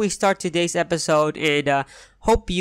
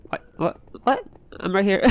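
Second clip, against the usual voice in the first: ordinary talk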